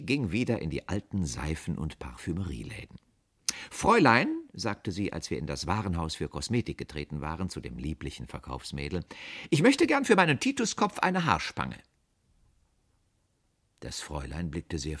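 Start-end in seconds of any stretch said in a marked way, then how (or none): 3.7-4.3 loud, high summons; calling the salesgirl. A narrator's reading. Aunt summoning the shop girl, loud and high. 9.5-11.8 high, loud, lightly voiced aunt; demanding old lady. A narrator's reading. Aunt ordering a hair clip, high and insistent. Aunt's voiced request to salesgirl.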